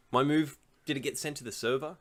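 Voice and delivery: ordinary delivery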